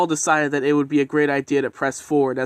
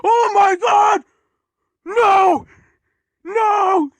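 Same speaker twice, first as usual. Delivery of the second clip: high, loud, slow horrified yell; horrified shout; 8 dB louder, much slower than usual. Acted. Signalman shouting in horror at the runaway train, horrified shout. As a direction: scream it out in shock. Signalman watches Edward thunder through.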